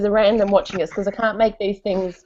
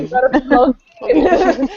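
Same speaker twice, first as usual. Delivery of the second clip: laughing retort; 6 semitones higher, 4 dB louder than usual. Retold or imitated. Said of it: "I'm like".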